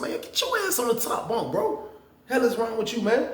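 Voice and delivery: heated retelling of a bunk fight; retold yell. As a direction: bark at the cellmate to move up. Retold argument over the bunk.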